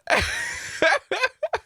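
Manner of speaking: high, teary laughter; laughing to tears. Real, not acted. Vlogger tearing up at a British clip, helpless laughter. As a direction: laugh until the eyes water. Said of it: "actually tearing up a little".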